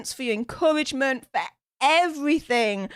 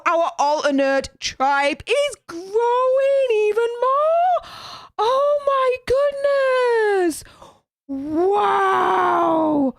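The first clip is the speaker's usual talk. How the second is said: thrilled; 7 semitones higher, slower than usual. Real, not acted. Subscriber count jumping live.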